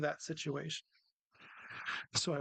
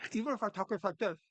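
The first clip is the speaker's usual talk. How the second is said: higher, put-on pleasant voice; nice-voice demo; 5 semitones higher, 3 dB louder than usual. Shown as a demonstration. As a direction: show off your nicest, higher voice. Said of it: "but I can have a tone".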